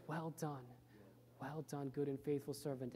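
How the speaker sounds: whisper-soft blessing from a loving father; tender praise. Acted. God welcomes the faithful servant, softly and tenderly.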